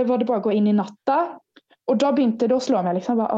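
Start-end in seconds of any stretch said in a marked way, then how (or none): none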